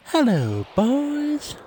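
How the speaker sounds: "boys" swoops up high in a put-on voice; sing-song hello from a mum at the door; imitating a cartoon mum's greeting; Cartman's mum. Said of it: "Cartman's mum opens the door and she just says".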